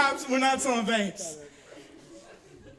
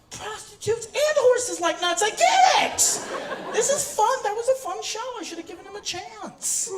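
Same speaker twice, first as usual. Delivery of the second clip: higher, excited mock realization; imitating a dawning listener; 9 semitones higher, 4 dB louder than usual. Audience member driving home getting the joke, excited. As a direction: play a fan who finally gets the joke. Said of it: "you're like".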